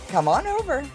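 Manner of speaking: ordinary talk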